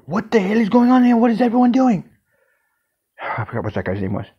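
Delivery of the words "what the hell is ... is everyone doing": boss's angry demand. Retold or imitated. Boss arrives at the chaos.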